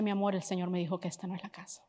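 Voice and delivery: soft, quick, low-key telling; quiet news. Retold or imitated. Husband telling his wife in the prayer room, quiet and quick. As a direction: break it gently and quickly.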